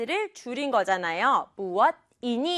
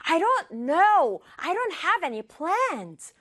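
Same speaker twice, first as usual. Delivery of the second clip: high-pitched exasperated outburst; mock frustrated shout; 5 semitones higher, much slower than usual. Teacher acts out the boy yelling at his sister, mock frustrated shout. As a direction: cry out in frustration, stretching the words. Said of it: "지유가 세상에 소리를 어떻게 질렀어요?".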